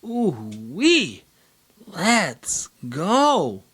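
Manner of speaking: hammed-up cheer; playful fake hype; mock excitement. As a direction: pretend to be thrilled. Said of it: "to a".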